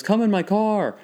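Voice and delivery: high, inviting mock Italian accent; retold Italian-accented stranger; Mario accent. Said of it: "classic Mario accent".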